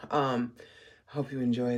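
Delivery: low groggy just-woke-up voice; sleepy morning voice, rough. Real, not acted. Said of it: "you get the morning voice going right now".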